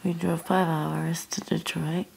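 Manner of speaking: hushed whisper. Real, not acted. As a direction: whisper it softly. Whispering late at night.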